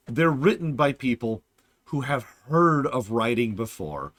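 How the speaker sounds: normal talking voice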